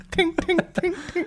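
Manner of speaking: high, softly ringing metal tings; imitated tinging. Comic riffing about a steel goblin, high imitated tinging.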